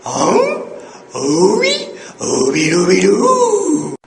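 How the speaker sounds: loud, drawn-out dog howl that cracks upward; Scooby-Doo. Shown as a demonstration. Yodel teacher imitating a cartoon dog, cracking howl. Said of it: "he sounds like this".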